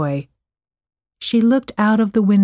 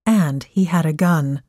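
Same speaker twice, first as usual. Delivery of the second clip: ordinary delivery, nothing marked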